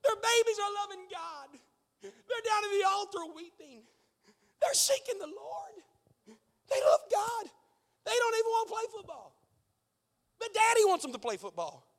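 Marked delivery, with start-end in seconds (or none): 0.0-7.5 crying, high and soft; tearful preaching. Real, not acted. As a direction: let the tears come through the words. Preacher weeping over children.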